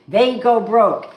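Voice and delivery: loud, high and slowly punched; emphatic. Real, not acted. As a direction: hammer home every word. Punchline about karma.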